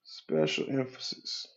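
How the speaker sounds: breathy, slowed-down whisper of the phrase. Shown as a demonstration. Coach echoing his key phrase to viewers, in a slow whisper. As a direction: let it out as a slow hushed echo.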